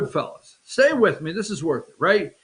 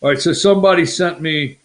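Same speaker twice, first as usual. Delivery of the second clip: normal talking voice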